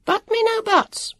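high, sharp, slowed rebuke; irritable. A narrator's reading. Woman cutting off Jonathan's protest, irritably. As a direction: cut the boy off crossly. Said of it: "in her irritable way".

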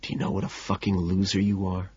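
very soft, gentle murmur; compassionate whisper. A narrator's reading. Narrator touches the man's face, whispering compassionately. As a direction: whisper it tenderly despite the cruel words. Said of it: "with compassion and whisper".

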